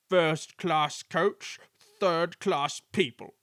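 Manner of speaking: drawn-out indignant grumble; rumbling complaint. A narrator's reading. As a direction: rumble it out slowly, word by word. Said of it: "would rumble".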